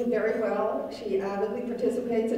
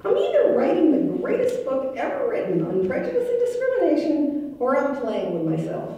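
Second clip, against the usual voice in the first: higher, louder declaration, dropping back at the end; quoting Dad; 5 semitones higher, 5 dB louder than usual. Retold or imitated. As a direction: imitate Dad's grand pronouncement, then let it settle. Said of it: "Dad would say".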